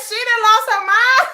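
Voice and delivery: high laughing shout; laughing onlookers. Pretend. Onlookers reacting to the fan losing it, laughing shout. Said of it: "They be like".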